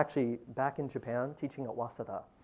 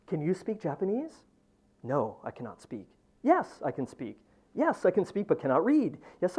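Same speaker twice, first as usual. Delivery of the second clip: loud, rising chant of repeated lines; poetry reading; 2 semitones higher, 6 dB louder than usual. Acted. Poet reads his translation to the audience, declaiming. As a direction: read each variation louder, building.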